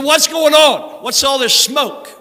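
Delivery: loud, high, slow shout of a furious father; angry father shout. Retold or imitated. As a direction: bark it like a dad catching his son smoking. Angry father bursts in on smoking son.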